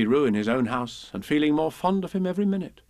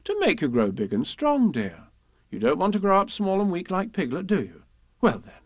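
lilting motherly persuasion; swooping, indulgent mother voice; coaxing Kanga; 7 semitones higher than usual. Acted. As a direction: coax a small child. Kanga coaxes child to medicine.

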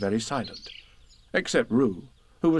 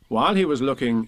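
plain reading voice

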